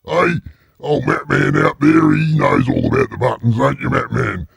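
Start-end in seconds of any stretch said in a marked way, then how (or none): none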